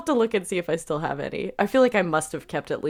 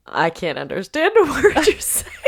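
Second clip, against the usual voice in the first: laughing; 7 semitones higher, 5 dB louder than usual. Retold or imitated. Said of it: "it was like".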